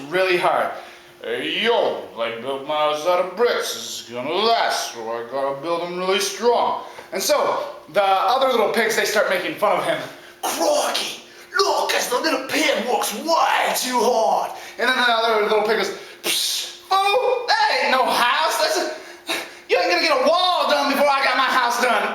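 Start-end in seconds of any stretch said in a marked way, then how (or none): 1.2-6.8 low, dopey-earnest bro voice; deep-pitched, steady working pig; hardworking third pig. Acted. 10.4-14.5 slow Aussie nature-host mockery; drawn-out Australian-style piggy; mocking first pig. First pig mocks his brother. 16.2-22.1 higher-voiced dismissive bro; slangy, jeering little pig; scoffing second pig. Second pig mocks his brother.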